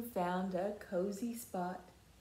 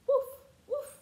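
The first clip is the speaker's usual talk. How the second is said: high, bright-sounding yappy puppy woof; puppy bark; 7 dB louder than usual. Acted. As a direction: bark like a little playful puppy. Dog barks in picture book.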